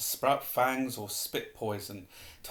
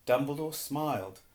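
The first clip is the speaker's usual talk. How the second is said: plain reading voice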